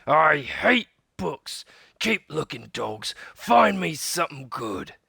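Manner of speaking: captain raging at finding only books; angry pirate. Acted. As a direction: snarl orders at the crew.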